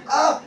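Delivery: high-pitched mock exclamation; imitated excited "ah". Preacher imitating her excited reply, high "ah". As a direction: mimic her wish to express something, high. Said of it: "She was very excited".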